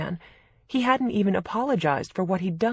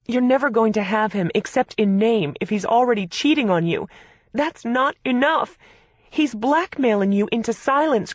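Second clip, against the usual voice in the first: furious sister; 6 semitones higher, 6 dB louder than usual. A narrator's reading.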